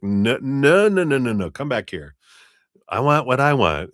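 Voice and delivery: insistent heart. Retold or imitated. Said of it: "the heart's like".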